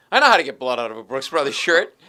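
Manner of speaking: very loud imitated know-it-all; retold eager boast. Lawyer offers colleague stain advice, loud eager imitation. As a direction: jump in loudly with expert advice.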